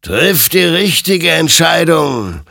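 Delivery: high, loud, slow warning; forceful admonition. A narrator's reading. Creature admonishes armed Hektor, loud slow warning. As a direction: press the command out, drawn-out and commanding. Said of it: "ermahnte ihn sein Gegenüber".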